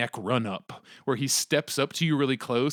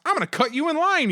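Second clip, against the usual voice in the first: re-enacted public announcement, pitched way up; high, raised-voice mock proclamation; loud announcement; 14 semitones higher, 4 dB louder than usual. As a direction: announce it to the whole line, high and indignant. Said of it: "I loudly announced".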